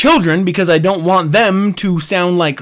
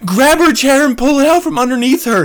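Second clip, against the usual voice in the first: ordinary talk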